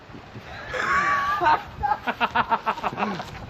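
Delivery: sudden high burst of laughter. Real, not acted. Laughs mid-sign-off.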